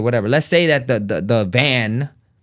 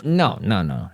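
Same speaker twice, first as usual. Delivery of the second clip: ordinary delivery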